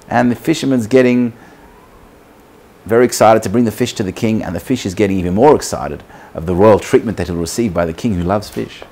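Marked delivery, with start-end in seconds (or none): none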